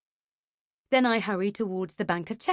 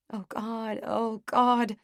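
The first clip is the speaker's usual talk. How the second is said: moaned despair; moaning; 3 semitones higher, much slower than usual. A narrator's reading. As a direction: moan it out. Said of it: "I moan".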